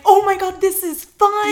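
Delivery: high, loud, thrilled squeal; excited. Retold or imitated. Two friends high in the bedroom, loud thrilled squeal. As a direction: squeal it like the best time ever.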